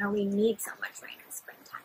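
soft voiceless whisper. Real, not acted. Teacher asking children a question, whispered. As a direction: drop to a secret whisper.

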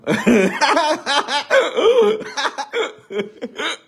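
long, very high laughing fit; helpless cackle. Real, not acted. Comedian after naughty bird joke, helpless cackle. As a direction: crack up helplessly at your own punchline. Cracks up at own punchline.

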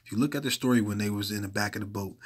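ordinary talk, nothing marked

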